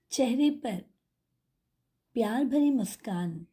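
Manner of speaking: guided meditation. Acted. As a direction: guide them gently inward, unhurried, leaving space between phrases.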